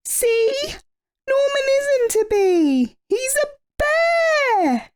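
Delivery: very high, slow shouted accusation; triumphant shout. A narrator's reading. Amelia exposing Norman to the bees, high triumphant shout. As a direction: unmask him triumphantly. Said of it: "said Amelia".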